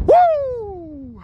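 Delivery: high joyful woo; excited cheer. Real, not acted. Mountain ticked off.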